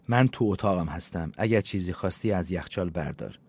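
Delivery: ordinary delivery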